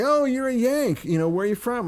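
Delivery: high, delighted-sounding greeting to a Yank; imitated northern English local. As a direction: mimic a welcoming stranger, pitch well up. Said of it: "And people would say".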